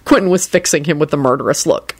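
plain reading voice

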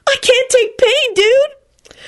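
high, slow dude voice; milk the fake pain; hammed-up Kenny. A narrator's reading. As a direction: dude whining about a rubber chicken. Kenny complaining dramatically.

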